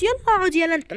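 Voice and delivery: high loud jab at the shy groom; teasing shout. Acted. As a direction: call him out loudly. (زعيق هزار على العريس)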